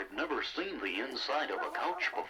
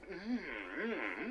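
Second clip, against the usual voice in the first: talking through a mouthful of food; chewing and humming happily; mouth full; 6 semitones higher, 5 dB quieter, much slower than usual. Acted. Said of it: "you're not supposed to talk with your mouth full".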